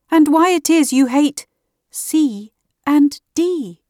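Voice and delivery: put-on hesitant young girl. A narrator's reading. Alice's voice, slow at end.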